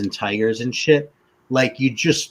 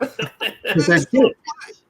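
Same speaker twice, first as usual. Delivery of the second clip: laughing; 6 semitones higher, much slower than usual. Real, not acted. Laughing while talking about dolphins.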